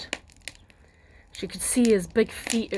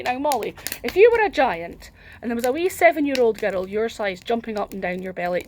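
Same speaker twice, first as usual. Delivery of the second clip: plain reading voice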